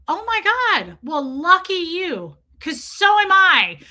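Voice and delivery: high, loud outburst of disbelief; outraged exclamation. Real, not acted. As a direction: blow up, shocked and indignant. Exploding in outrage at the dad.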